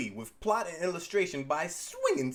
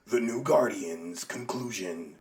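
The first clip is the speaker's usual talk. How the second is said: low, slow mock-announcer voice; dramatic title voice; 7 semitones lower, slower than usual. Host announcing the comic's title, low and dramatic. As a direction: drop low and draw the title out.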